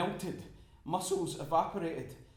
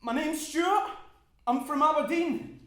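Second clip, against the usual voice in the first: much higher and louder, laughing keep-awake chatter; frantic cheer; 10 semitones higher, 10 dB louder than usual. Acted. Keeping a dying man awake.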